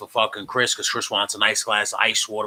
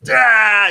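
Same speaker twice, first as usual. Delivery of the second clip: sudden loud, high wail; imitated scream; 9 semitones higher, 9 dB louder than usual. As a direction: cry out like someone screaming for help. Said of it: "she starts screaming for fucking Chris".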